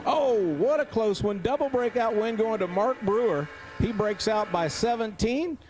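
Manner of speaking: announcer thrilled by a close finish; live race call. Real, not acted. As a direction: call the photo finish as it happens.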